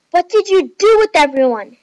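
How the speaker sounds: high, loud accusing shout; screamed demand. A narrator's reading. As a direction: scream the question at them. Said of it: "Nikki and Chloe screamed".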